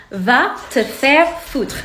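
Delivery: Va te faire foutre, spelled out slowly in French; slow French insult. Shown as a demonstration. Telling viewers what to say to a jerk, slow French. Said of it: "just tell them".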